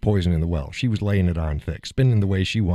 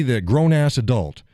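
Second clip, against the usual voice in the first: normal talking voice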